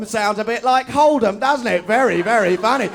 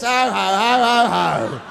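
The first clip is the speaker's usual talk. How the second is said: mock hearty laugh; much slower than usual. Mocking the sitcom laugh.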